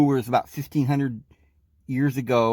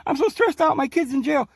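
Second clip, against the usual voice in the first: much higher pleading voice, a scared patient; imitating a scared patient; 13 semitones higher than usual. Patient to the doctor, much higher, mock-scared pleading. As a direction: whimper it out like a panicked patient.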